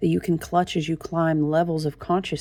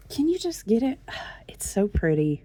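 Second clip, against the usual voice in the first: whispered, then a sigh of admiration; 3 semitones higher, 3 dB quieter than usual. Real, not acted. Vlogger fumbling with her book, whispering. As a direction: hush it to herself.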